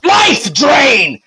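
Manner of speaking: high, shouted spell name. Acted. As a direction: yell the attack like a spell. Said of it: "and he goes".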